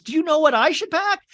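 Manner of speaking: put-on high, eager kid voice. Kevin asking his brother, high and eager. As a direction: ask it like an excited little boy. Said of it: "And Kevin goes".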